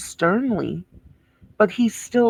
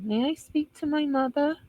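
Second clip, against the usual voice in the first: mocking her request; 7 semitones higher, 4 dB quieter than usual. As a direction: sarcastically echo her sweet little question. Parroting the arrested woman's request.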